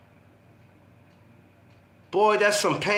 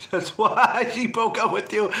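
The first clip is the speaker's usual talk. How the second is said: words through laughter; laughing; 3 semitones higher than usual. Real, not acted. Friend teases the comedian mid-laugh, laughing through the words. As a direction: tease him while cracking up.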